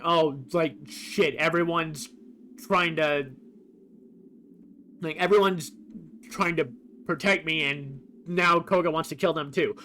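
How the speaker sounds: normal talking voice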